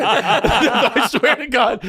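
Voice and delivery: high, drawn-out words through laughter; laughing hard. Real, not acted. Comedian swearing the wreath story is true, laughing. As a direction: swear it while cracking up.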